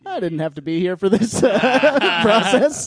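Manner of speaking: laughing through the line. Retold or imitated. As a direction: laugh while complaining. Own retort retold.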